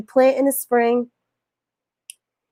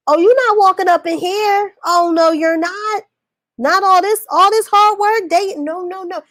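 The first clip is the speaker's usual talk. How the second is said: sassy refusal; 7 semitones higher than usual. Acted. Defending her progress, sassy refusal.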